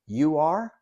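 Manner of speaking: "You are?" with the pitch rising on "are"; rising end. Shown as a demonstration. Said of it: "I raise my voice at the end".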